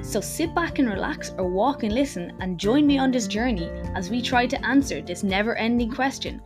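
announcer intro voice over music; upbeat show opener; podcast intro. Acted.

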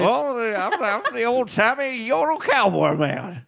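put-on high, swooping old-timey cowboy voice; old-timey western sidekick with a yodel. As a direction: put on a cracking yodel-guy voice, pitched well up. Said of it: "who's the yodel guy?".

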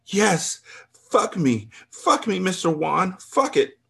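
high, slow, breathless yes; aroused moaning. A narrator's reading. Woman begging the trainer, high aroused moaning. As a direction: moan it up high. Narrator voicing her aroused moans.

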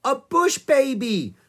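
high, loud, drawn-out exclamation; excited cry. A narrator's reading. Annie spots a tiny bush baby, cries out excitedly. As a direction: cry out with a child's delighted discovery. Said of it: "cried Annie".

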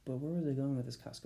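ordinary talk

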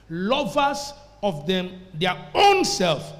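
slow phrase with "own" punched loud; stress on OWN. Shown as a demonstration. Preacher drives the verse home, emphasis on "own". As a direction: lean hard on OWN. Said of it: "making the emphasis key".

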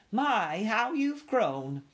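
high, slow, gushing exclamation; fussy relative marvelling in a high voice; gushing aunt. A narrator's reading. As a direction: coo it up high, stretched out. Gushing aunt greets nephew.

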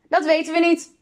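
put-on high, light child voices; high-pitched kids' reply; children calling back. A narrator's reading. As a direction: answer like children up on deck. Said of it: "riepen de kinderen".

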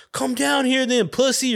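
high-pitched mock challenge; retold kid's goading; imitated taunt.

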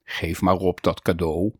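low-pitched muttering Christmas figure; deep, grumbly festive mutter; mumbled Santa ho-ho-ho. A narrator's reading. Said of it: "en mompelde".

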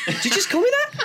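very high-pitched mock disbelief; retold shocked reply. Friend answering drunk workmate's insult, mock shock. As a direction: squeak it out in mock offence. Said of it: "I was like".

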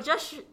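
'Gesture' with a SH sound, said through laughter; laughing mispronunciation. Retold or imitated. Retelling her slip to friends, laughing mispronunciation. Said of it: "And I was like".

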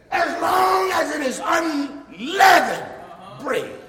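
loud, high, shouted sermon, slow in places; full-force preaching. Acted. Preacher rousing the congregation about communion, full-force preaching. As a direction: preach it out to the back pews.

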